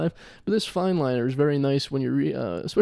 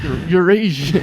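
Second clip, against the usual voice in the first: slow, loud pun on Eurasian; loud, drawn-out punchline; drawn-out mock accusation; 3 semitones higher, 7 dB louder than usual. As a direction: stretch the words out loudly for the joke.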